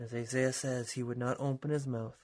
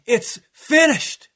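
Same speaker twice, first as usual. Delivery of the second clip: slow, huge shout, far higher and louder; a dying king's triumphant roar; large shout; 12 semitones higher, 17 dB louder, much slower than usual. Acted. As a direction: cry it out slowly with the whole body. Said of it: "exhale with Jesus in this large shout".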